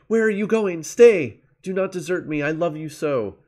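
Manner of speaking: loud, high desperate calling out; calling after him. Acted. Narcissus calls after his fading reflection, loud high calls. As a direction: cry out after the vanishing image. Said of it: "He called after".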